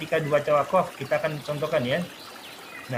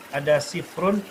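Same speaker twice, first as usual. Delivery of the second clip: ordinary talk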